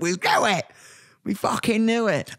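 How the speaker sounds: high-pitched mock fan outburst; imitating excited fans. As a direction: play thrilled fans crowing in triumph. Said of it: "And they're like".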